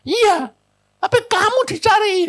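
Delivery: high, loud retold protest; insistent wife. Wife's acted reproach on phone. (tiruan istri mendesak, tinggi dan keras)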